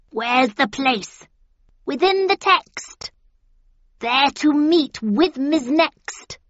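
high, slow witch chant. A narrator's reading. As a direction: intone it like a crone.

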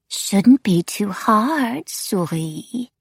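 high, drawn-out, lilting wraith voice; eerie taunting wraith. A narrator's reading. Wraith taunts the narrator who wants solitude, eerie and drawn out. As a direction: stretch the words out into a teasing lilt.

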